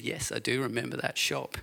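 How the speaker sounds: normal talking voice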